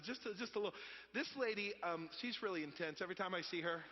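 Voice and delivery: normal talking voice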